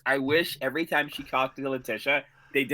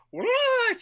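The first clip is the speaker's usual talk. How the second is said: very high, crazy-person squeal; mock frantic. Co-host imitating Jessica running about, frantic mock squeal. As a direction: squeal it in hammed-up disbelief. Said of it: "like a crazy person".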